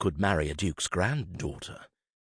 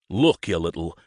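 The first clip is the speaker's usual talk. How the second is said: growl beginning; 6 dB louder than usual. A narrator's reading. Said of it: "he began to growl".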